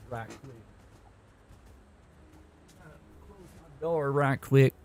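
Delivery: put-on playful American South accent; Southern accent. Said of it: "I love doing that accent".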